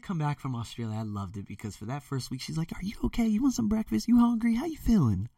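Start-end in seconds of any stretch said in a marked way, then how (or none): 2.7-5.3 high, fussing mother voice; caring mom. Retold or imitated. Mom to son back from Australia, eager concern. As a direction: imitate a doting mother, pitched way up. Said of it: "that feeling of care".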